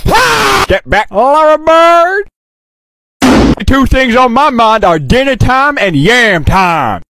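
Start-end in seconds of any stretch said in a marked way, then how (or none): none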